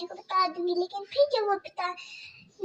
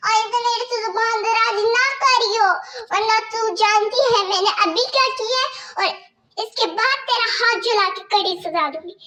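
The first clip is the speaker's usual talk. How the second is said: harsh stepmother scolding; 3 semitones higher, 5 dB louder than usual. Acted. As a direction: bark orders at the girl like a cruel stepmother. Stepmother warns.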